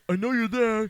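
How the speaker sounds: high, loud mock threat; acted threat. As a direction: shout at a hidden intruder, imitating panic. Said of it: "he's like".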